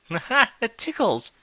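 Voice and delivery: high, giggly squeal of delight; giggling. A narrator's reading. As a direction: giggle it out, tickled. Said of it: "Twilight giggled".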